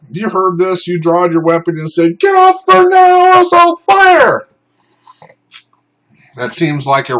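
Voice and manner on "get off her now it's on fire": slow, high, loud commanding yell; mock police shout. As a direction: bark it like drawing a weapon. Armed bystander shouting command.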